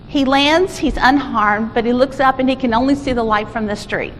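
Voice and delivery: ordinary talk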